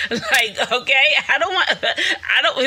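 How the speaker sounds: high, loud laughter breaking into the words; laughing. Real, not acted. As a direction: laugh your way through it. Laughing at own answer.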